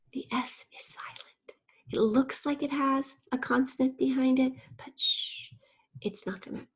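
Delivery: playful whisper. Acted. Teacher whispers the silent S.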